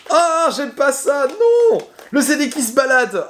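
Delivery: real alarm at a loose disc in the case; collector's dismay. Real, not acted. Spotting a loose disc in the case, he cries out in real alarm. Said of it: "la peur du collectionneur".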